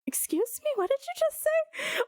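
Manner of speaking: high, disbelieving double-take; as if you can't believe what you just heard; incredulous. Retold or imitated. Streamer retelling her reaction to the clip, incredulous. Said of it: "I was just like".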